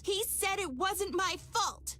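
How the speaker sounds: upset cry. Acted. Argument with her mirror self.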